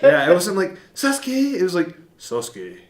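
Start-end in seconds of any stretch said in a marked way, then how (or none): 0.9-1.5 put-on high-pitched anime boy calling out; squeaky-high imitation of a boy hero; young Naruto. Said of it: "Naruto became manly". 2.2-2.8 lower, softer mock-manly voice; put-on low manly anime voice; manly Naruto. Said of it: "Naruto became manly".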